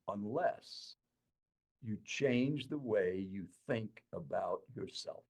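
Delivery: soft and slow, each word landing; quiet, solemn counsel after a pause; hushed, weighty advice. Retold or imitated. Boss's pivotal advice, hushed.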